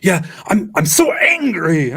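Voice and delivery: loud, high mock fury; over-the-top pretend anger; hammed-up fake shout. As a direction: bellow it like a bad actor. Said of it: "That's basically what's happening".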